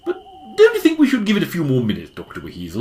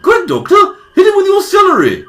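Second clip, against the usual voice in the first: higher, louder urgent cry; urgent shout; 8 dB louder than usual. Acted. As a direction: yell it as a battle order. Urging the doctor to attack.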